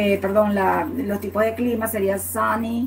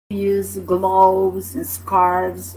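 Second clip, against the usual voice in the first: slow teaching; 2 semitones lower, much slower than usual. Shown as a demonstration. Teacher models English slowly.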